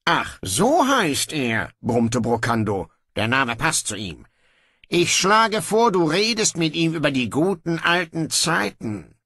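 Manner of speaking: a lazy king giving in; offhand young king. Acted. Brocando grudgingly orders guards to release his brother, breezy.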